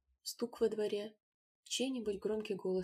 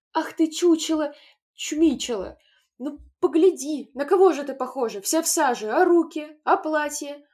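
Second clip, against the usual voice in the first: loud, higher furious scolding; 6 semitones higher, 13 dB louder than usual. A narrator's reading. Mother to Taiska, loud scolding. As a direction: berate the dirty child.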